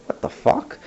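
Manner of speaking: breathy whispered disbelief; whispered reply. Retold or imitated. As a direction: mouth it in a hushed whisper. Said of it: "And she responded with".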